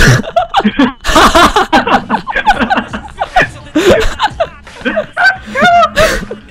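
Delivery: loud high-pitched laughing fit. Real, not acted. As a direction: burst into wild laughter. Big laugh after dying.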